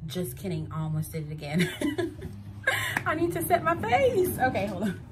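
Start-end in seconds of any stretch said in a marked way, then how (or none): 1.7-3.0 high unvoiced giggle at herself; high breathy laugh. Real, not acted. Laughs at own slip. 3.0-5.0 high and loud through laughter; laughing. Real, not acted. Laughing self-correction.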